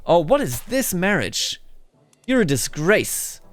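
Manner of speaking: normal talking voice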